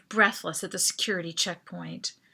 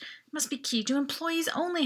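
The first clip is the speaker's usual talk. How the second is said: usual speaking voice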